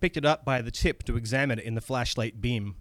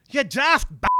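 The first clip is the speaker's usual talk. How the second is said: high, loud Scottish outburst; bad Scottish accent; 12 semitones higher, 5 dB louder than usual. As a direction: scold in a hammed-up Scottish accent. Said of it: "my bad Scottish accent comes in now".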